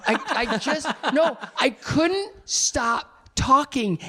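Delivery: real embarrassed giggle; laughing embarrassed. Real, not acted. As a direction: laugh at your own awkwardness. Embarrassed memory of meeting [name].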